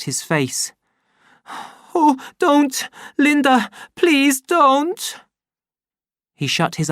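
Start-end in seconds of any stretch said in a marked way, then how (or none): 2.0-5.2 put-on high, pleading child; frightened plea. The boy begs his mother not to hit him again. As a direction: shield yourself and beg.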